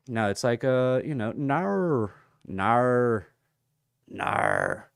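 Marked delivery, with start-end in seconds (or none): 1.3-4.9 drawn-out mock Australian "no"; Australian accent. Streamer imitating how Australians say no, broad mock accent. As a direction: exaggerate the Australian vowel in "no". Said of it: "the way that Australians say no".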